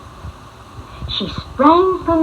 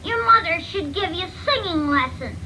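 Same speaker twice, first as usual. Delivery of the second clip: put-on chirpy baby birds; 7 semitones higher than usual. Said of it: "said the birds".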